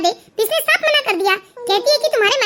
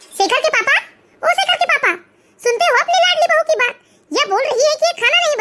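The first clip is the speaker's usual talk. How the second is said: higher, raised call to her husband; mother-in-law calling her husband to complain, raised voice; 5 semitones higher than usual. Acted. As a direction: summon him across the house. Calling husband from another room.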